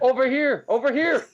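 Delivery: excited high-pitched call; all excited. Acted. Skag waving a friend down to his table, all excited. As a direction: wave them over excitedly. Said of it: "All excited".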